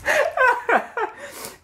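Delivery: long high laughter. Real, not acted. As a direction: burst out laughing. Laughing at racism joke.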